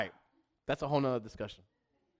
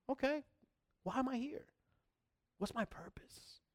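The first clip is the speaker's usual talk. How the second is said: whispered self-question; 6 semitones higher, 15 dB quieter than usual. Acted.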